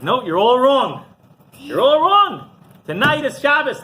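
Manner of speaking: high, loud mock outrage; stubborn indignation. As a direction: act out defiant, emphatic insistence. Rabbi defies the whole town.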